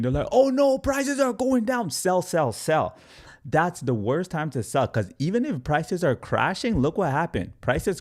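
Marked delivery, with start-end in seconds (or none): 0.3-3.0 much higher, hammed-up panicking investor voice; over-the-top panic, voice jumped way up; mock panic. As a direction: squeal it like a scared seller dumping coins. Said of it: "people start panicking".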